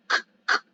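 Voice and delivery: "k, k" as short, sharp k bursts with no vowel after, each cut off at once; stopped k sound. Shown as a demonstration. Teacher showing students the Greek kappa stop, short k bursts. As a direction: tap out "k, k" as quick stops that cannot be held. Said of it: "de mantener el sonido de una K".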